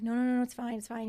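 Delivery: quickly waving away someone's worry; retold hurried reassurance. Brushing off son's worry while sobbing.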